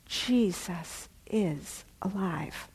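slow, soft, reverent realization; hushed awe. Retold or imitated. Friend describing her walk, hushed awe. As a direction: breathe it like a revelation.

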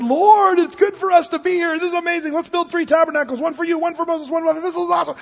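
high, gushing mock Peter; excited babbling Peter. Overawed Peter blurting to Jesus on the mountain, excited babble. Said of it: "he just starts speaking".

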